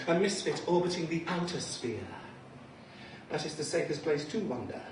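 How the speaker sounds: ordinary talk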